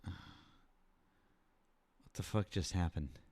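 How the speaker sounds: usual speaking voice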